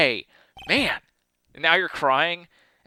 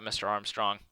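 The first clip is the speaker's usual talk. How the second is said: ordinary delivery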